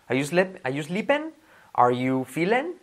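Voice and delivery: usual speaking voice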